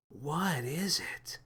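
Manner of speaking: soft, slow breathless whisper; awed gasp. A narrator's reading. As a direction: gasp it out in awe. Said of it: "Lily gasped".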